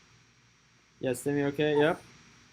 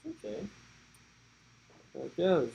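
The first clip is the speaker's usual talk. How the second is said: higher and much softer comfort; hushed soothing; 3 semitones higher, 7 dB quieter than usual. Real, not acted. Dad hushes crying baby Remy, high and very soft.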